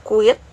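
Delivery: teacher demo, quit stressed; stressed word. Shown as a demonstration. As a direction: hit quit hard and loud. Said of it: "the first syllable on word".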